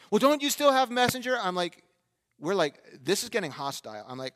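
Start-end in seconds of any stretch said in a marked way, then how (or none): 0.1-1.4 imitated insistent woman, pitched higher; confronting woman. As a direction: press the question in a high mock female voice. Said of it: "I hear a female voice from a car".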